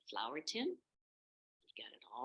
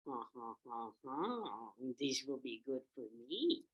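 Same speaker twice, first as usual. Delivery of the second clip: low, soft villainous chuckle; scheming wolf aside; gloating wolf; 3 semitones lower, 4 dB quieter than usual. Acted. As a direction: chuckle slyly to yourself as the wolf.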